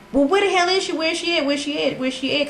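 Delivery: high, urgent, repeated questioning; frantic demand. Retold or imitated. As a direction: start loud and high, keep pressing. Retold frantic demand for cousin's whereabouts.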